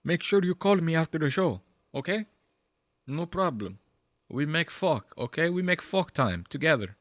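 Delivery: quiet come-on in a put-on accent; imitating Ari. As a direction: play a clumsy foreign suitor. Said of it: "And then he's like".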